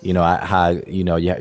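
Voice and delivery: normal talking voice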